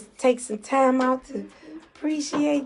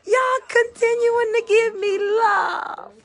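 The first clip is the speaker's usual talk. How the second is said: real tears, pitched up and louder, voice breaking; crying; 8 semitones higher, 6 dB louder than usual. Real, not acted. Singer overwhelmed by fans' hearts on the livestream, crying. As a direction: sob out the thanks as it overwhelms you. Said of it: "I'm gonna cry again".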